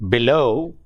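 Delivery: "below" with the weight on "low"; below, light "be", long stressed "low"; stress on second syllable. Shown as a demonstration. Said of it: "the stress is on the second syllable".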